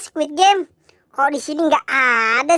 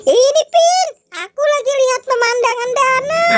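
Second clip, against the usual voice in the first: much higher, bright little character voice; high cartoon friend; 9 semitones higher than usual. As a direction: chirp like a small toy friend. Depu character voice. (suara tokoh kecil melengking)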